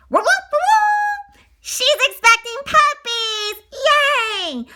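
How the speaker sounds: thrilled high hooting; excited cheer. Acted. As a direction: cheer for the coming puppies. Happy cheer over puppies.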